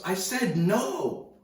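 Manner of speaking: high, loud, drawn-out protest; incredulous yell. Retold or imitated. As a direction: shout it slowly in exasperation. Retelling the surfer's incredulous scolding.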